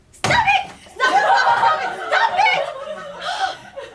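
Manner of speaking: furious repeated yelling; heated family shouting. Acted. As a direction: scream at the kids to stop. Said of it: "I lost it".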